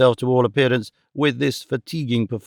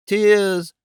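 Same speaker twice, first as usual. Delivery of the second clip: cried out; 7 semitones higher than usual. A narrator's reading. Old gentleman crying out at Mrs Nickleby's tears. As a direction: burst out at the sight. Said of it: "cried the old gentleman".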